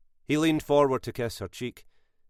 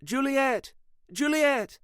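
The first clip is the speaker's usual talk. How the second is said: high, calling out across the garden; woman calling her dog by name; slower than usual. A narrator's reading. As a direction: call the dog back from afar. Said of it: "Sylvia called to her".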